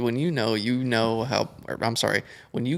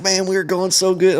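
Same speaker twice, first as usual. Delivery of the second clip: high and a bit louder, stoked; excited; 8 semitones higher, 4 dB louder than usual. Retold or imitated. Buddy stoked about the session, excited. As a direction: relive the thrill of the ride. Buddies hyped after riding.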